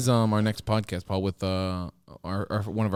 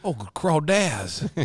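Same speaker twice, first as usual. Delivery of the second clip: slow, higher Southern drawl; Southern accent; 4 semitones higher, much slower than usual. Shown as a demonstration. Co-host riffing on crawfish, slow Southern accent. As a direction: stretch crawdads out in mock Southern. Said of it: "I feel like this accent's slightly".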